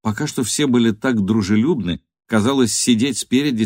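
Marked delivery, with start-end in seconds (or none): none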